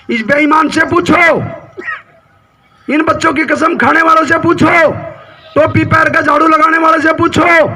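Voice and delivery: full-voiced political speech, phrase by phrase; forceful stump-speech delivery; rally oratory. Real, not acted. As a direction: address a big crowd in emphatic, sustained bursts.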